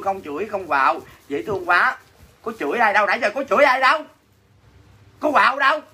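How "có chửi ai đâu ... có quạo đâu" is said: mock-indignant protest, pitched up; play-acted crossness. Seller denies scolding anyone on her livestream, play-acted crossness. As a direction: put on a rising, higher-pitched show of being cross. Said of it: "diễn chơi vui thôi chứ thật ra không có quạo".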